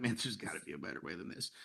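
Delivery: defeated murmur, low and quiet; frustrated sigh. Retold or imitated. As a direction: say it like another dead-end morning. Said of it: "and be like".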